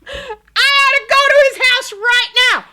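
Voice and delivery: very high, loud mock rage; instant overreaction. Husband threatening to go to the house, hammed-up yelling. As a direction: yell it in hammed-up outrage. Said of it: "he's from zero to a hundred".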